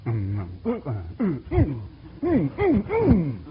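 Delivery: low grumbling "mm" of refusal, rising at the end; stubborn refusing hums. Retold or imitated. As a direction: hum a sulky no, over and over. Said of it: "that old human nature says".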